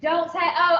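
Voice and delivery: ordinary talk, nothing marked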